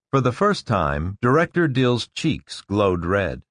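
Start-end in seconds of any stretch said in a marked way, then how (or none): none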